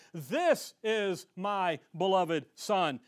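slow, deliberate reading with "This" drawn out and punched; stress on THIS. Shown as a demonstration. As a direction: lean hard on "This", spacing each word. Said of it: "Why does he say "this".

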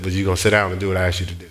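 low, quick, even order; matter-of-fact, unruffled command; calm firm instruction. Retold or imitated. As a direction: lay down the rule without heat. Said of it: "I said it exactly like that".

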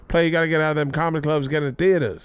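high-pitched, animated mimicry of a pushy mentor; impression of a mentor. Comic retelling of a mentor urging theaters and tour buses, high-pitched impression.

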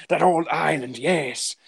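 lightly voiced pirate drawl on "That old island"; a hint of a pirate. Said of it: "Now I'm a pirate suddenly".

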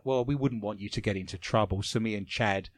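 usual speaking voice

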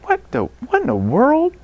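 high-pitched, incredulous what-in-the-world; retold disbelief. Coach spotting a man yelling at his kids, incredulous. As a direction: act out baffled disbelief, voice leaping up.

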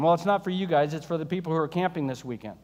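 ordinary talk, nothing marked

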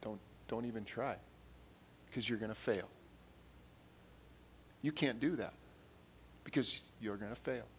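soft, hushed voice of self-doubt; little discouraging inner voice. Acted. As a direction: quietly talk them out of trying, with pauses. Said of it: "This little voice that says".

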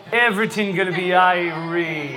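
slow Caribbean accent, stretched final word; easygoing, drawn-out Caribbean lilt. Shown as a demonstration. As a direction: demonstrate a relaxed Caribbean accent for the crowd. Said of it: "in your best Caribbean accent".

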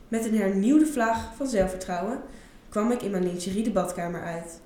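ordinary delivery

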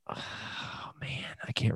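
whispered aside to himself; whispering. Real, not acted. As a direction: breathe it as a quick whisper. Aside while searching his memory.

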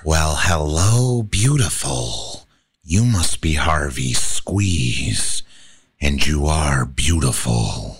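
low, purring Joker voice. Shown as a demonstration. Joker greeting Harvey, low and menacing.

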